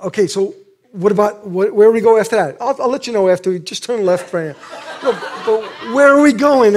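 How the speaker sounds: mock exasperated back-and-forth; frustrated, laughing. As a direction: nag for the whole route. Retold car argument with wife.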